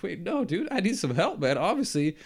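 mock plea for help. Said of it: "I'm like".

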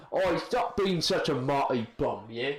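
mock British lad; British slang delivery, tag question at the end; British accent. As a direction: put on a casual British accent. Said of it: "a lot of these people sound British".

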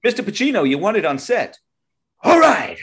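high, loud call across a set. Pretend. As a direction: call him to the set loudly. Mock set runner calling Pacino.

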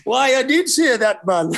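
mock Geordie with a laugh, "why man"; Geordie accent. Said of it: "So you gotta speak like them".

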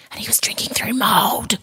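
horrified hushed whisper. Real, not acted. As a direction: breathe it out in horror.

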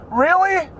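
high, quick, hammed-up girly voice; mock eager volunteer. Comedian voices a plus-size model offering to wear a bikini, high and giddy. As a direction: play it like a giddy model begging to pose.

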